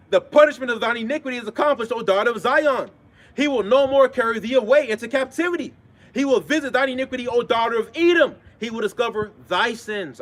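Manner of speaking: ringing scripture proclamation; scripture reading. Acted. Reads scripture aloud.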